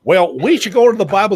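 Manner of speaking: lightly hammed-up governor quote; mock governor. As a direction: mimic a pious governor piping up. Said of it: "one of these governors comes up and says".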